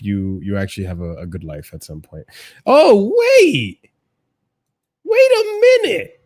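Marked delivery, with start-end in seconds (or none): 2.6-6.2 high, loud cry of discovery; loud high-pitched double take; sudden realisation. Real, not acted. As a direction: shout it out as you suddenly spot something. Sudden realisation while reacting.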